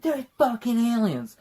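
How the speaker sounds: hammed-up alarm; mock alarmed yell. Kid telling dad about aliens, mock alarmed yell. Retelling movie scene, kid yelling.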